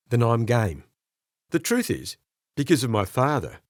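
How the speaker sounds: ordinary delivery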